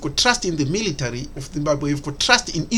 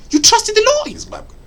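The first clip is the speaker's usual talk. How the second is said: incredulous quote; 5 dB louder than usual. Retold or imitated. International community voiced, incredulous.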